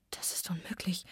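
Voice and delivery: whispered to herself, barely voiced; soft whispered disbelief; inner whisper. A narrator's reading. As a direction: breathe it to yourself in disbelief. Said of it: "Ich sagte mir".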